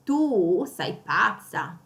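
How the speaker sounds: slow, tu stretched out long; tu held long and strong; stress on tu. Shown as a demonstration. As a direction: drag out the tu. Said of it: "see how I stress the tu".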